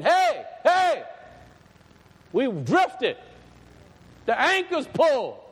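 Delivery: high, loud prophet's call; crying out a warning. Acted. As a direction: call out to the crowd in urgent bursts. Preacher voices John crying out warning.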